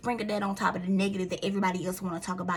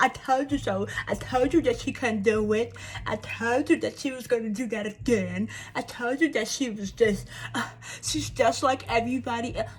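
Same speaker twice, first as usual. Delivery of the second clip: smug gossip voice, a bit louder; mocking told-you-so; 2 semitones higher, 3 dB louder than usual. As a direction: sneer it like a know-it-all neighbour. Imitating gossiping people saying told you.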